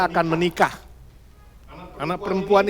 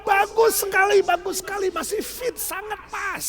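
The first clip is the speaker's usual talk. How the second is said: high, excited cheering voice; family praising; 13 semitones higher than usual. Acted. Family cheering as Betty wears the dress, high and excited. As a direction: gush with praise. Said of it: "tepuk tangan".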